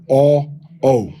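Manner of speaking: "o" said between ah and o. Shown as a demonstration. Teacher models the in-between vowel. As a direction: round "o" halfway from ah. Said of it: "between ah and o".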